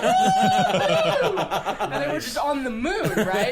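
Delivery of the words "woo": high, loud whoop of joy, laughing; excited whoop. Retold or imitated. Racers cheering their second chance, a loud laughing whoop. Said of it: "And they were like".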